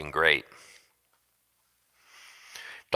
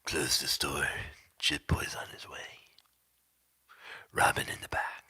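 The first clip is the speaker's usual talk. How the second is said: mock whisper; 3 semitones lower, 12 dB quieter than usual. Mock announcement of store closing.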